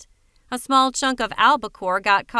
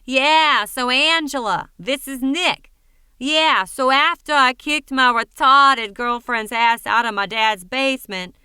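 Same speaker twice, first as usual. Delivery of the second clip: put-on high, nasal, thick New England accent, louder; mock Nick, New England accent; 4 dB louder than usual. Lori mocking Nick's voicemail to Angela, thick New England accent. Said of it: "making her voice high-pitched and nasal and putting on her thickest New England accent".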